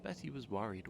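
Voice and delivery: plain reading voice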